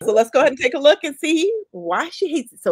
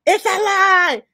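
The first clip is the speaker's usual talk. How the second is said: higher and louder, echoing her rant; emphatic agreement; 7 semitones higher, 5 dB louder, slower than usual. Retold or imitated. Cohost echoing the woman's rant, loudly agreeing. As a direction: punch it like you mean it. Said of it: "She's like".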